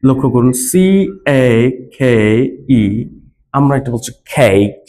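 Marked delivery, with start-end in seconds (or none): 0.7-2.8 letter by letter with pauses; C, A, K, E spelled with pauses. Shown as a demonstration. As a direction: spell it slowly, one letter at a time. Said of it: "example স্বরূপ বলি".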